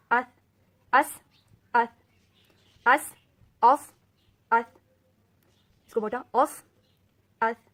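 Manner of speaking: اس thin and crisp, اوف heavy and thick; sibilant drill. Shown as a demonstration. Teacher drilling sibilants for students, loud. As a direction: repeat each in turn. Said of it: "فرق معلوم ہوا؟".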